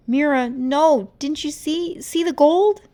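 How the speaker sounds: high, loud frantic plea; desperate pleading. Acted. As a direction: make her see it. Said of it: "Rumi tried again".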